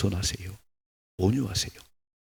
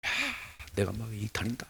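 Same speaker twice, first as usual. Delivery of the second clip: showing off; 8 dB quieter than usual. Retold or imitated. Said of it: "이렇게 막 폼을 잡았는데요".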